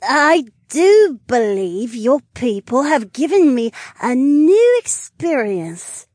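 giddy tipsy lizard mage; tipsy woman voice. Acted. Drunk Naomi voiced.

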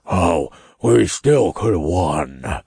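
slow, sulky demon grumble; heavy, disgruntled voice; grumbling. A narrator's reading. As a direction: grumble like a bulky sore loser. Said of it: "I heard Mammon grumble as he waddled past".

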